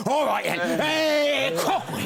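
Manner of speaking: mock Aussie presenter winding up; hammed-up, drawn-out Aussie presenter; wound-up impression. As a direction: imitate an Aussie host revving himself up. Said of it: "I like how you literally wind yourself up".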